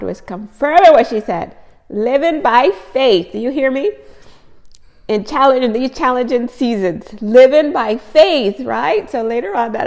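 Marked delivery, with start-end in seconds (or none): 1.9-3.3 slow, louder, leaning on each word; driving the phrase home slowly; emphatic slow. Real, not acted. Preaching the verse with emphasis. 7.2-8.6 slow, louder, leaning on each word; driving the phrase home slowly; emphatic slow. Real, not acted. Preaching the verse with emphasis.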